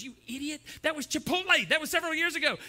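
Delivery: loud, high exasperated correction; impatient scolder. Acted. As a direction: shoot the worrier down loudly. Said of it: "And the red person goes".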